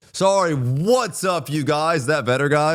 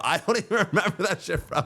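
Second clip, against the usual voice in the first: laughing; 3 semitones higher, 6 dB quieter, faster than usual. Real, not acted. Laughing at own drunk messages.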